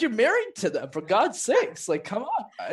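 laughing scold; exasperated disbelief. Retold or imitated. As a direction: throw up your hands at them, laughing. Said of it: "I was like".

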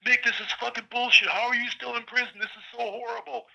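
imitating a furious rant. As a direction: replay his drunken outrage at the injustice. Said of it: "like, furious".

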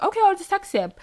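high-pitched, agreeable retold reply; lightly put-on easygoing reply; compliant retake. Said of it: "Not even like that".

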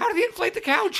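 high-pitched, exasperated question; incredulous outburst. Real, not acted. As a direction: squeal it in comic disbelief. Mock outrage at plot holes.

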